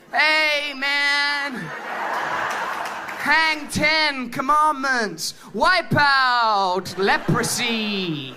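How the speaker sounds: slow drawn-out surfer dude; laid-back stoner surfer. Retold or imitated.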